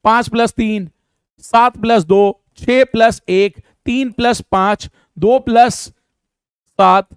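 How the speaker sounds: slow, spaced-out dictation of sums. Shown as a demonstration. Teacher dictating sums slowly to the class. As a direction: read each sum slowly for students to write. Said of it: "फटाफट जोड़ के answer लिखते जाना आप".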